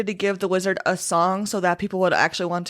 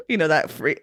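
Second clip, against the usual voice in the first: ordinary talk, nothing marked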